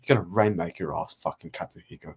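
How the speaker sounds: low, fast trash-talk growl; mock Okada promo. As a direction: ham up a wrestler's promo threat. Imitating Okada's fired-up promo.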